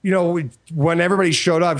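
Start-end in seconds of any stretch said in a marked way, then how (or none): none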